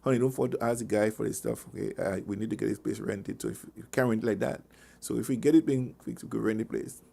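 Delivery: soft, low, gentle request; asking nicely. Retold or imitated. Spouse asks nicely over dinner, softly imitated. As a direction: imitate a sweet dinner-table reminder. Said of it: "being nicely thinging to you".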